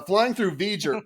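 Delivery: normal talking voice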